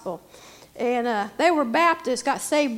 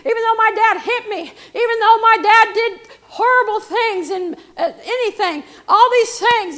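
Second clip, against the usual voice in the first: loud, high preacher's cry; impassioned preaching; 7 semitones higher, 10 dB louder than usual. Acted. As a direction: preach it at full force, stretching the key words. Preaches at full force.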